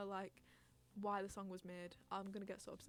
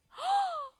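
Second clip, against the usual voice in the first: very high, loud squealed gasp; short burst of delighted squealing; excited squeal; 18 dB louder than usual. Real, not acted. Streamer thrilled by a friend joining, excited squeal.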